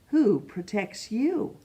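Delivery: ordinary delivery, nothing marked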